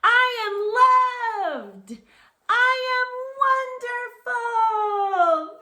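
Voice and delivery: high, slow, loud chant; loud as you can. Shown as a demonstration. Teacher chanting loudly with the whole class. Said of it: "Loud as you can".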